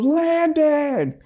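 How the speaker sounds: high called-out name, hammed up; mock yell calling a son. Mom calls her son to the door, a light mock yell. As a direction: call him from the back of the house. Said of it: "And she yells back".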